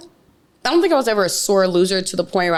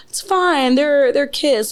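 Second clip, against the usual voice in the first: easygoing husband; 7 semitones higher, slower than usual. Retold or imitated. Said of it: "He's like".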